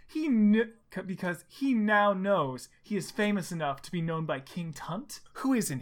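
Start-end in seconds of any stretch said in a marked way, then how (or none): none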